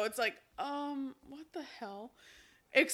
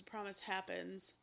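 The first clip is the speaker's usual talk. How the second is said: ordinary talk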